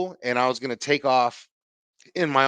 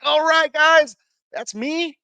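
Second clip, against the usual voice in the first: mock leader call; 13 semitones higher, 7 dB louder, much slower than usual. Exit leader calling to kids.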